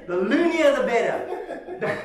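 words breaking into real laughter; laughing. Real, not acted. Preacher teasing about being loony with his wife, laughing.

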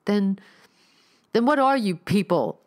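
high, loud, frightened stammer. Acted. Neil, surrounded by cultists, stammers in fear. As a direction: trip over the first word in panic. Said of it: "Neil stammered".

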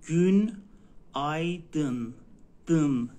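گون، آی، دن split into separate syllables. Shown as a demonstration. Teacher correcting student, spelling out syllables. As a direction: break the word into گون، آی، دن.

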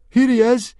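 put-on high, loud boy's yell. Fishlegs presents Hiccup to the chief, yelling. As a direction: yell to point out your friend. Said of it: "yelled Fishlegs".

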